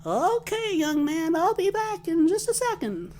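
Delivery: sweet granny promising to come back; old lady customer. Retold or imitated. Customer quoted telling the clerk she'll return, high old-lady voice. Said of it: "She goes".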